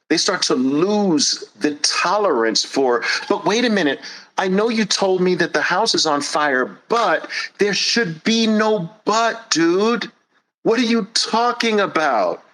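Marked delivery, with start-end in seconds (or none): 3.3-12.4 high, pleading whine; mock protest, high and nasal; whiny excuse. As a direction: whine like a stalling child. Whiny objector.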